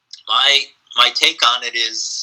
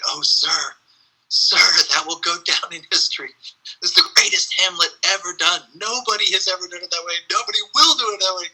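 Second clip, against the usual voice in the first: fast, higher, breathless praise for his master; gushing dresser; 6 semitones higher, faster than usual. Retold or imitated. Dresser gushing to Olivier after Hamlet, fast and high. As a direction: rush out the praise like an awestruck servant. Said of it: "His dresser came into the room and said".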